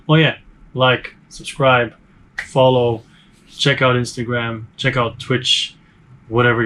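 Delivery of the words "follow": ordinary delivery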